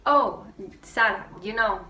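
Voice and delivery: ordinary talk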